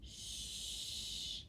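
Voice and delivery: "Sh-" as a long voiceless hush; isolated sound. Shown as a demonstration. Teacher segments shy, "Sh-" held long. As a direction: sustain "Sh-" softly with no vowel. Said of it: "Pull down the circles with me".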